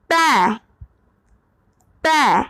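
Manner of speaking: पै with an open ai and a slight ya glide at the end; पै ends with a faint ya sound; pron demo. Shown as a demonstration. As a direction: let पै trail into a light ya. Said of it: "या हल्का सा last में".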